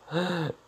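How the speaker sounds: sharp high gasp. Retold or imitated. Girls seeing him shirtless gasp in shock. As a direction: suck in air in shock.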